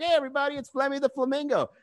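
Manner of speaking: high-pitched flamingo puppet greeting. Acted. Comic voices his puppet for the crowd, puppet voice. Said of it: "I give him, like, a voice".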